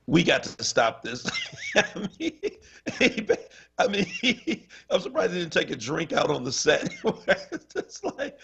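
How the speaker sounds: high-pitched, giggling retelling; laughing while talking. Real, not acted. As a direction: talk through a fit of laughter. Cracking up while retelling broadcast.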